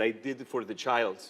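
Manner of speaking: lightly imitated Egyptian accent; Egyptian driver's accent. Driver explaining himself to passenger dad, light Egyptian accent. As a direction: put on a hint of an Egyptian accent. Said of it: "the Egyptian driver".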